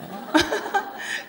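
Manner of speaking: short rising laugh at herself. Real, not acted. Preacher laughing at her own dozing, light laugh.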